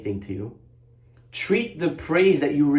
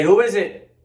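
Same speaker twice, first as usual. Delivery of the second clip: higher, louder call through a closed door; 7 semitones higher, 6 dB louder than usual. Retold or imitated. Boy calling through the locked door, louder. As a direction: call out to whoever knocks.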